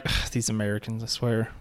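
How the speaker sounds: soft, low exasperated grumble; mock exasperation. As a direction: grumble under the breath, mock fed-up. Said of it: "You should have been like".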